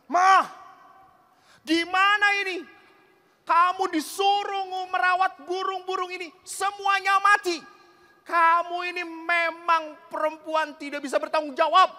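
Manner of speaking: high, loud scolding yell; yelling at wife. Acted. Husband yelling at his wife over dead birds, high loud yell. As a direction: blast her for the dead birds. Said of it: "Langsung dia teriak".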